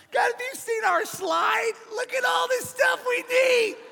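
high-pitched hammed-up whining; mock pitiful wail. Pastor begging God over the building costs, mock pitiful wail. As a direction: whine frantically to God, voice way up high.

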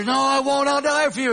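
hint of a bold disciple swearing loyalty; Peter's insistence. Peter insisting he won't deny.

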